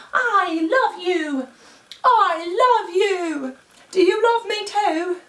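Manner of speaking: put-on high, slow, doting British accent. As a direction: coo a sing-song British accent at the cat. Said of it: "He does not like my British accent".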